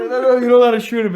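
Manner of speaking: fast, high, laughing no-no-no; mock scolding partner. Friend retelling the movie scolds the cop, fast and high. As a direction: rattle it off laughing like a flustered partner. Partner voiced in retold scene.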